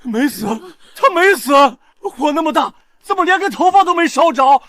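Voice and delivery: high, alarmed cries; panicked disbelief. Acted. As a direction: cry out as if seeing a ghost. Sees the supposedly dead woman alive.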